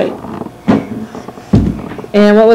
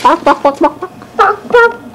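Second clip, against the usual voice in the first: dog bark; 8 semitones higher, slower than usual. Shown as a demonstration. Parent imitating dog.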